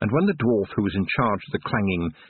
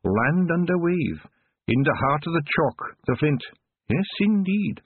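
slow, much higher, knowing voice; musing old witch; 8 semitones higher than usual. Acted. Old witch musing aloud to Tiffany, knowing. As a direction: savour the words like a riddle. Proust muses, relaxing.

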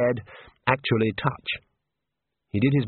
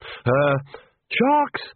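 sheepish academic; 3 semitones higher than usual. A narrator's reading. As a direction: squeak out the awkward realisation haltingly. Same academic, now sheepish.

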